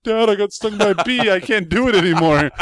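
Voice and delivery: high, loud mock wail to dad; retold distressed cry. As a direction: cry out like you've just been stung. Retold cry to dad after sting.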